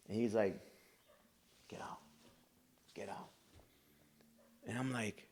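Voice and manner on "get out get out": quiet dismissal. Retold or imitated. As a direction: send him off quietly. Gang leader quietly releasing him.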